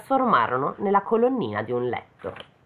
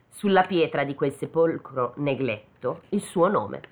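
usual speaking voice

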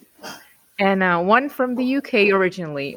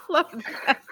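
laughing; 8 semitones higher, 5 dB quieter than usual. Real, not acted. Laughing while saying love.

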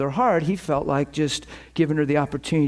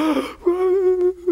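a sharp high gasp of awe; sudden loud high gasp; 3 dB louder than usual. Retold or imitated. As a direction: gasp like something just hit you. People receiving the Spirit.

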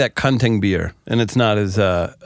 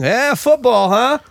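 dumb small talk; 13 semitones higher, slower than usual. Retold or imitated. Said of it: "you'd have a dumb little thing".

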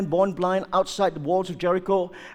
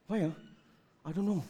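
usual speaking voice

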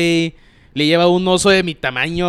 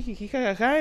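mock giggle; 7 semitones higher, 4 dB quieter than usual. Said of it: "siempre ha sido jiji, jajaja".

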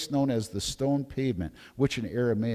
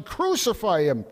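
higher, louder crowd cry; first crowd shout, raised; read crowd shout; 8 semitones higher, 4 dB louder than usual. A narrator's reading. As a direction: lift it as the mob's demand. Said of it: "they kept shouting".

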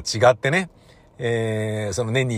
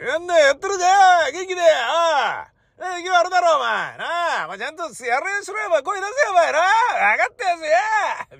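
much higher, gruff mock lecturing; bossy festival elder; 14 semitones higher than usual. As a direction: boss the young ones like a festival old-timer. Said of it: "みたいな".

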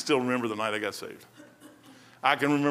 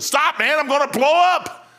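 higher and louder, overflowing with joy; excited cry to the Lord; 12 semitones higher, 8 dB louder than usual. Retold or imitated. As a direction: cry out as if about to burst. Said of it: "I said".

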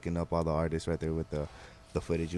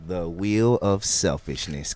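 slow, drawn-out, louder game-show intro; game announcer; 6 dB louder, much slower than usual. Pretend. Host introduces the wheel game to viewers, announcer style. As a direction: announce the segment big and slow.

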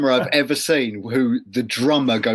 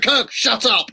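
raised mock bark at a bandmate; high, pushy brush-off, lightly hammed; 9 semitones higher, slower than usual. Bandmates cutting [name] off mid-sentence, higher mock scolding. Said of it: "they're like".

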